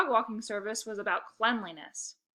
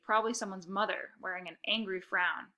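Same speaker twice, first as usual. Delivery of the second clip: plain reading voice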